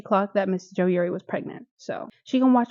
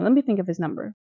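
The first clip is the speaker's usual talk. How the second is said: ordinary talk, nothing marked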